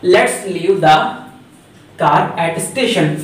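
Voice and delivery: reads the English sentence out very slowly; slow dictation read. Shown as a demonstration. Teacher to students, reading the example sentence very slowly. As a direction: spell each word out for the class. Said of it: "क्या कहा?".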